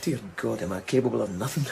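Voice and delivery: quiet self-loathing, rushed at the end; despairing murmur. Acted. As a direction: breathe it out in despair. Alone, despairing.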